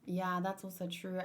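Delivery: ordinary delivery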